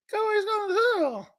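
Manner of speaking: very high squeaky dummy voice, quick; ventriloquist's puppet. Acted. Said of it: "like a ventriloquist".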